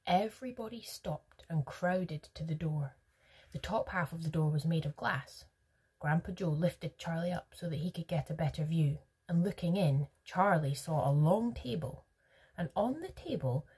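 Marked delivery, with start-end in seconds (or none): none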